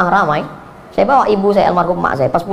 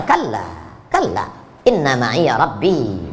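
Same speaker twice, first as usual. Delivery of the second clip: soft, slow, steady Musa; calm reassurance; 8 semitones lower, 5 dB quieter, much slower than usual. Acted. Musa answering his frightened people, softly and slowly. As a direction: settle the panic with a hushed certainty.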